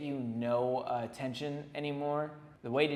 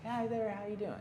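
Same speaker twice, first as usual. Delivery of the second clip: friendly client; 6 semitones higher, 4 dB quieter than usual. Retold or imitated. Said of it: "who just is like".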